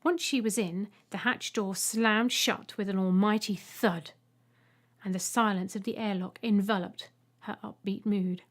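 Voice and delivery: plain reading voice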